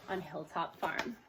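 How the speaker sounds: ordinary delivery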